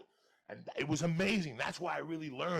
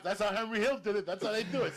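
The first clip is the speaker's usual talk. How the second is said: ordinary delivery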